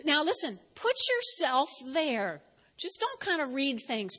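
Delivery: ordinary talk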